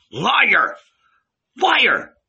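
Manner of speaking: high, loud accusing shouts; enraged shout. A narrator's reading. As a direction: yell the accusation in rage. Jesus interrupts Paul, enraged.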